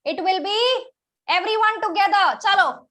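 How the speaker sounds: ordinary delivery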